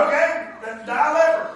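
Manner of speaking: high, loud, laughing; mock dismissal. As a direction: brush him off with a laugh.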